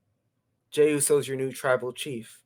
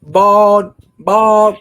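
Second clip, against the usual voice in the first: high, booming one-word jeer; loud heckle; 5 semitones higher, 14 dB louder than usual. Real, not acted. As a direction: blurt it out loud, drawn out and teasing. Loud heckle about Claudio's head.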